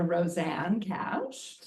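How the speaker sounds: usual speaking voice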